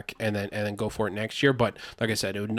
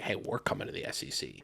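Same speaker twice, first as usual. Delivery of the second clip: whispered taunt; 4 semitones lower, 11 dB quieter than usual. Retold or imitated. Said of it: "now it's like".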